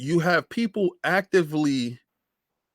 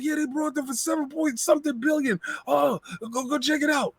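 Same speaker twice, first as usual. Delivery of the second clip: imitating Boom's excitement; 13 semitones higher than usual. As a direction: squeal it like a friend losing his mind over the news. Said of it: "He's like".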